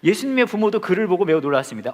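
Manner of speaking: normal talking voice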